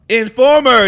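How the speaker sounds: loud, high exclamation; excited recognition. Real, not acted. Finally remembers [name]'s song, excited outburst. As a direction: belt out the title as it comes back. Recalls the song title with delight.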